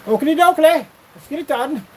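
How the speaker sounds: high, loud goofy cartoon voice; cartoon neighbor voice. Answering a question with a goofy cartoon catchphrase. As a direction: chirp it like a cartoon sidekick.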